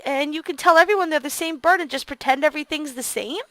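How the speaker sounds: normal talking voice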